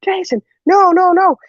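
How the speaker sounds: panicked yell. Retold or imitated. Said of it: "I'm like".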